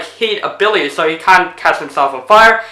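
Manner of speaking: ordinary talk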